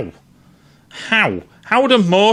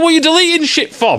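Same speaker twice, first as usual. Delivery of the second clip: mock angry yell; 11 semitones higher, faster than usual. Yelling at his own computer.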